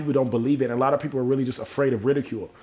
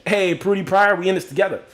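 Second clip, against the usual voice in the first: higher-pitched, pleased call-out; excited greeting; 6 semitones higher than usual. Real, not acted. Host greeting a viewer in the chat, excited. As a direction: hail a friend with enthusiasm.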